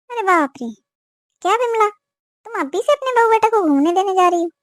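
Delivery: high-pitched, scandalised exclamation; shocked disbelief. Acted. Jamuna aunt hears the couple is going travelling, shocked. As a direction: react aghast, pitch leaping up. Neighbour shocked at the honeymoon plan.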